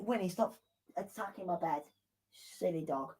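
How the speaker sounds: quietly scolding the pet dog; soft aside to dog. Real, not acted. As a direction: tell the dog off under your breath.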